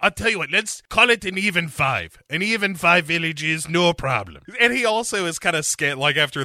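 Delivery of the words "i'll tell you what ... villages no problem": put-on high, bargaining castaway in a mixed accent; hammed-up foreign-accented haggling; Jon Voight's muddled accent. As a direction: round it off breezily in a mongrel accent. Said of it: "So Jon Voight jumps onto the boat".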